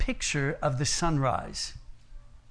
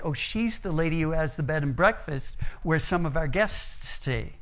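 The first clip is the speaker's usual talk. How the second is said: normal talking voice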